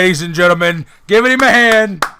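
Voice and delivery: loud, high announcer call. Acted. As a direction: hype up the guest's entrance. Announces guest.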